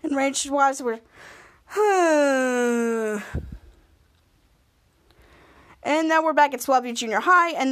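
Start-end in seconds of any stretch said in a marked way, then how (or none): 1.7-3.5 long drawn-out exhaled sigh; slow, sinking sigh. Retold or imitated. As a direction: let out one long sigh. Retells Ray and Schwartz's reaction.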